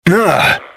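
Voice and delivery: high-pitched, jolted "ugh"; startled groan. Acted. Hit by something strange mid-talk, high startled groan. Second sudden disturbance interrupts him.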